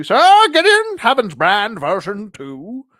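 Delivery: high, loud, drawn-out announcement; grand proclamation. Pretend. Hyping the toy's full name, grand proclamation. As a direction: proclaim it big and slow.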